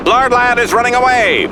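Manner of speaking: high, sustained excited cry; excited announcer's call. Acted. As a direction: call the retreat like a sportscaster. Calling the monster's retreat.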